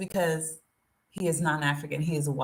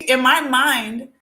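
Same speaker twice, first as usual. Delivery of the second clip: normal talking voice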